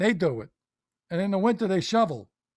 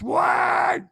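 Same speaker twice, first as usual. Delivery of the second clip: high shouted disbelief; outraged what; 12 semitones higher, 6 dB louder than usual. Acted. Comic husband shouts in disbelief at neighbour's work. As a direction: yelp it out in shock.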